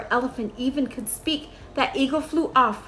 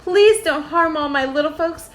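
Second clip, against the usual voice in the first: higher, louder plea; begging; 5 semitones higher, 5 dB louder than usual. A narrator's reading. Horton begs the eagle to spare the Whos, pleading. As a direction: plead desperately for the little folks. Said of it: "and begged".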